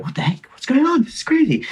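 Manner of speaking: shocked. Retold or imitated. Dragged off bike at checkpoint.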